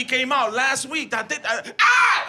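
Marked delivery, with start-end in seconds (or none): 1.8-2.2 high loud startled scream; sudden shriek. Shown as a demonstration. Comic demos his own scream in the car. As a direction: let out a sharp shriek. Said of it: "Like that".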